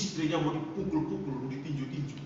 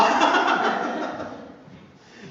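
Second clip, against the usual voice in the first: loud, high burst of laughter; big real laugh; 11 semitones higher, 9 dB louder than usual. Real, not acted. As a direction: laugh out loud at your own joke. Laughs after his mock prayer.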